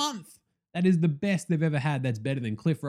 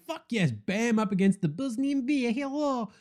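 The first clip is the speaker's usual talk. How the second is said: retold excitement; 8 semitones higher than usual. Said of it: "I was all excited".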